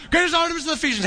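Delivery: high-pitched mob chant; mock crowd shout. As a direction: imitate shouting along without full volume. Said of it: "Just shout".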